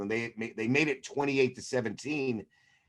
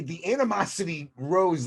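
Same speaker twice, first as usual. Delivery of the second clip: normal talking voice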